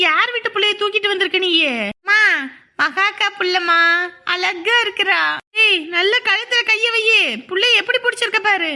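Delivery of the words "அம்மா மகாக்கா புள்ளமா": normal talking voice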